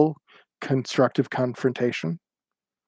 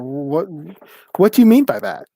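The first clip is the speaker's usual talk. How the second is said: much higher, louder disbelieving question; incredulous challenge; 6 semitones higher, 4 dB louder than usual. Retold or imitated. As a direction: demand he explain himself. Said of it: "the option to say".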